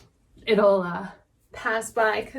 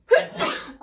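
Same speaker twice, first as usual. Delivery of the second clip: high voiceless sneeze; acted sneeze, higher pitched; 9 semitones higher, 3 dB louder than usual. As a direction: sneeze with a sharp high intake. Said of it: "a little bit of a sneezing fit".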